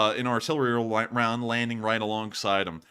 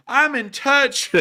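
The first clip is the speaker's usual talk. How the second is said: much higher, louder and slow, a phony politician voice; mocking politician; 13 semitones higher, 8 dB louder, slower than usual. Streamer mocking out-of-touch politicians, hammed-up high phony voice. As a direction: lampoon a pandering politician. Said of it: "politicians out there who go".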